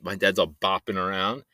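ordinary talk, nothing marked

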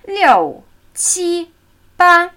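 "Liu" falling loudly, "qi, ba" held high and level; falling then high tones. Shown as a demonstration. Teacher counting in Chinese by tone pattern, falling then high tones. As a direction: drop hard on "Liu", then keep "qi, ba" high and even. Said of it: "Also fallende Ton, zwei hohe".

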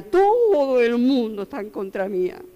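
slow whiny lament; a believer slowly moans that everyone is against them; drawn-out self-pity. Retold or imitated. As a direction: stretch "todo el mundo está en contra mía" slowly, dwelling on "todo". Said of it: "empezamos a ver el problema".

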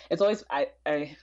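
halting false starts; stuttering. Real, not acted. Guest hesitant over a loaded relationship question, stuttering. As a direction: stumble over your opening words, hunting for a way in. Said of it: "I'm stuttering because talking about".

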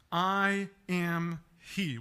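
slow, deliberate I am he; simple and short. Shown as a demonstration. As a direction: lay each word down calmly. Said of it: "very simple, short response".

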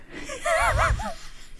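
high-pitched mock laugh; acted high giggle; imitated laugh. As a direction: laugh high, as the amused killer. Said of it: "And he's just laughing".

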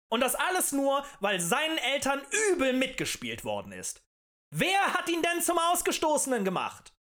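high, loud orator's pitch; impassioned tavern agitator; rabble-rousing harangue. A narrator's reading. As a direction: stir up a crowd with indignation.

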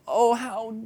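put-on self-pitying moan to God; hammed-up pitiful plea; mock whiny complaint. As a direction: whine it like a guilt trip. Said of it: "guilt him enough of".

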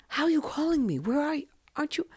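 high, disbelieving dad on the phone; a stunned father who thinks she is grounded, pitched high; shocked dad. Retold or imitated. Dad on the phone hearing she escaped, high and shocked.

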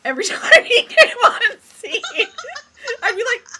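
high, loud, slowed by laughter; laughing hard. Real, not acted. Fan confesses squeaking in the cinema, laughing loudly.

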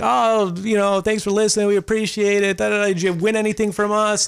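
lightly hammed-up radio host cheer; imitating cheery radio host. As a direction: play the chirpy host greeting a fan. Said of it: "would have had a conversation like".

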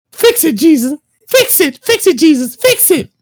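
high, far-off wailing prayer; hammed-up plea to Jesus; desperate plea. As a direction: cry up to heaven in mock panic. Praying in panic.

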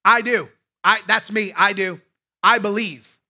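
loud, eager "I do" repeated; loud confident confession; bold declaration. Pretend. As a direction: declare it proudly for him. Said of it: "Nicodemus should have said".

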